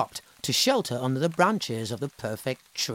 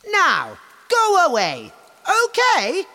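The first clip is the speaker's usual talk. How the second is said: higher, louder haughty tree voice; vain tree; 8 dB louder than usual. Acted. The tree refusing animals, haughty and high. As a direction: sniff it out haughtily. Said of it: "said the tree".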